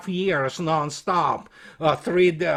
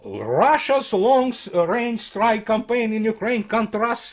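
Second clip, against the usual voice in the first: reading out a report, slightly raised; report read aloud; 5 semitones higher than usual. Acted. Commentator reads critics' report to his audience, formal read-aloud.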